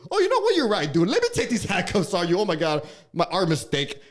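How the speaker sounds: high, loud fake realization; over-the-top pretend contrition; sarcastic mock apology. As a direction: gush like a suddenly apologetic cop. Mock imagined apologetic cop.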